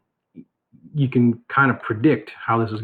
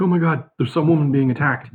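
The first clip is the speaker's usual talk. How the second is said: higher, louder mock-alarmed quote; retold alarm; 5 semitones higher, 5 dB louder than usual. Girl waking the household at two in the morning, mock alarmed. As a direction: imitate an alarmed report, pitched up.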